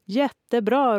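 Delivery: high, loud and keen; eager shout. A narrator's reading. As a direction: burst out full of game spirit. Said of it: "ropade Maja".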